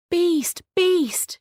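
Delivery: high, loud calling; Beauty crying out for the beast. Acted. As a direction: call out searching for him. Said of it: "she cried".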